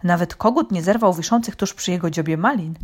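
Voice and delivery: ordinary delivery, nothing marked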